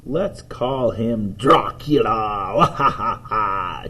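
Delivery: drawn-out mock-villain name and laugh; slow, theatrical vampire-style cackle; spooky Dracula laugh. Boy proposing a name for the rabbit, spooky mock-villain delivery.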